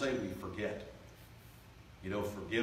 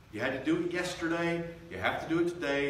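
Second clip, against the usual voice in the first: preaching emphasis; 7 semitones higher, 5 dB louder than usual. Acted.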